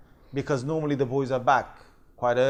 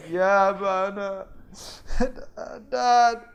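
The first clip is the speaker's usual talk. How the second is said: slow, high, louder hammed-up sobbing; fake crying; 7 semitones higher, 6 dB louder, much slower than usual. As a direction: wail out the lie to their father. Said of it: "came with this whole thing of crying".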